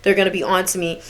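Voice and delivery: low, hushed paranoid repetition; quiet, anxious self-talk. Retold or imitated. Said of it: "so paranoid".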